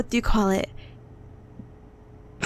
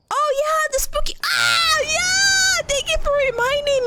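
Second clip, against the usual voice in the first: excited realisation shout; 12 semitones higher than usual. Real, not acted. A viewer reminds her of the spooky part, excited shout. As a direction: shout it as it suddenly comes back.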